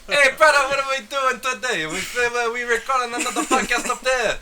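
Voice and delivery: high, loud French Canadian wrestler voice; goofy French Canadian wrestler impression; French Canadian accent. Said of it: "And a French Canadian accent".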